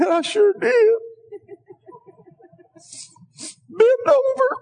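very high, squeezed retold voice; high strained reply. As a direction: push the father's words way up high. Father voicing his emotional reply.

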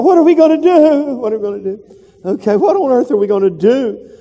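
high frantic worry, repeated; panicked servant. Acted. A hint of a frightened servant, voiced high, surrounded by the enemy army. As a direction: wail it like a scared servant. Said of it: "Tell somebody that".

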